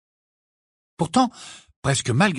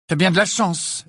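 higher-pitched, cool retort; cold; 6 semitones higher, 3 dB louder than usual. A narrator's reading. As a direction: answer coldly in a raised pitch. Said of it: "répliqua-t-elle avec froideur". (réplique froide, plus aiguë)

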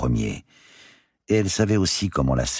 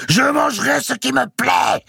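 very high, loud scream; enraged howl; 6 dB louder than usual. A narrator's reading. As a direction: bellow back at her, pitched right up. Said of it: "hurla Mao".

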